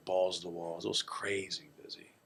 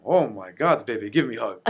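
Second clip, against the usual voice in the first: loud, hammed-up accented dad; dad's accented gushing; 2 semitones higher, 10 dB louder than usual. Dad asks her mom for a hug, loud accented gushing. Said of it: "It's your accent".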